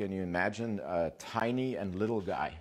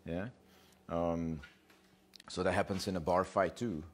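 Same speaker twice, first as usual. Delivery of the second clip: normal talking voice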